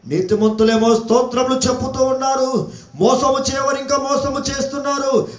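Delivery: sustained, ringing prophetic proclamation; preaching. Real, not acted. Preacher warning earth's inhabitants from scripture, full preaching voice. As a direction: proclaim it like a warning, stretching the endings.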